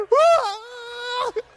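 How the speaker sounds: high, loud fake wail; hammed-up sob; mock bawling. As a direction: imitate a long hysterical cry. Mimics wife bawling.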